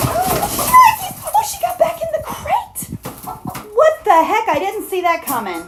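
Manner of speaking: high, loud shocked exclamation; surprised outburst. Real, not acted. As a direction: blurt out in disbelief. Hen jumps back in crate.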